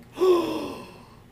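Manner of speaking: loud high imitated gasp. Son imitating mom's huge gasp, loud and high. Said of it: "biggest breath of air".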